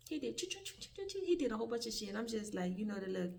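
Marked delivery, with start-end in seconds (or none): 0.1-1.2 mock beeping. Imitating cashier punching keypad.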